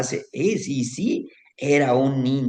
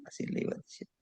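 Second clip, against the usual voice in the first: fading whisper; 15 dB quieter, much faster than usual. A narrator's reading. Narrator fading out the repeated line as the healing goes on, whispered. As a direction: let the repeat fade into a breathy whisper. Storyteller trailing off repeated phrase.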